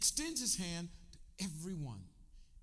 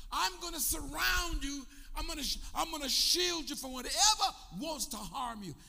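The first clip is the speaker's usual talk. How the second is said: full-force preaching; 8 semitones higher, 5 dB louder than usual. Acted. Preacher to congregation, booming God's promise in full force. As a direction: thunder out the promise of protection.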